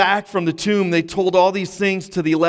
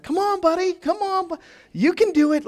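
high sing-song coaxing, like talking to a puppy; coaxing the dog; 13 semitones higher than usual. Retold or imitated. Owner coaxing his dog in the rain, high sweet coaxing. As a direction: sweetly urge the little dog on, much higher. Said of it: "you know how it starts".

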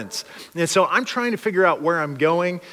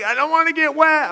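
high whiny refusal; whiny girl; 11 semitones higher than usual. Retold or imitated. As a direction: pout it like a stubborn teen.

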